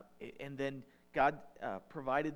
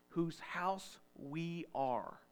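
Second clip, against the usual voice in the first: whose house we are drawn out very slowly; slow emphasis; 2 semitones higher, much slower than usual. Shown as a demonstration. Preacher repeating the verse for the congregation, slow emphasis. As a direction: lean on whose, slow and deliberate. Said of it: "He says".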